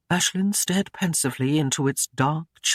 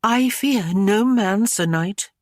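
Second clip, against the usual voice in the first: higher, slow and defiant; proud lady; 5 semitones higher, 4 dB louder, slower than usual. Acted. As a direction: lift the chin and stand firm. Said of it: "lifted her chin proudly".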